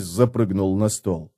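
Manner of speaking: ordinary delivery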